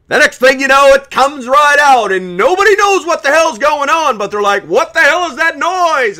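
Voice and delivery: very loud, very high roaring voice; loud gung-ho soldier. Shown as a demonstration. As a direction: roar it out like a drill sergeant fired up. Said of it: "I call it my man voice".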